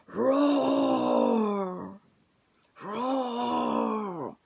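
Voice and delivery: loud mock lion roar, drawn out. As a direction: roar like an angry lion for the kids. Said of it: "He was going, / he was roaring".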